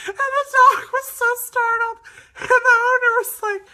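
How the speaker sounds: barely getting it out through giggles; laughing while telling. Real, not acted. Narrator telling viewers about the startled dog, laughing hard. Retelling funny dog incident.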